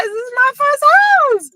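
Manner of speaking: overwhelmed, pitching right up as it hits you; emotional outburst at seeing his first house again. Real, not acted. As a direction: voice jumping high with real emotion. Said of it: "I'm so emotional".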